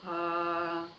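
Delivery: steady mid-range "ah"; middle tone. Shown as a demonstration. Teacher demonstrates a medium sound for children, held middle note. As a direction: sustain an "ah" in the middle register. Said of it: "fazer um som médio".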